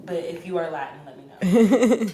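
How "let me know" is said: laughing. Real, not acted. Joking to Latin listeners.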